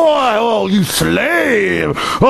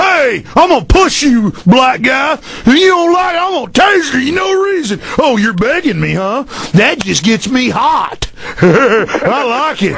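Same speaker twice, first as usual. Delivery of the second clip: imitating bullying cop; 2 semitones higher than usual. Radio host imitating a bullying cop for callers, shouted sneer. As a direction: bark it like a power-drunk cop lording over citizens. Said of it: "that's an imitation of 'em".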